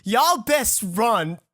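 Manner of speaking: drawn-out, slow mock warning; slow, grave warning to customers. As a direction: warn the customers slowly and gravely. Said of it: "I tell every other customer".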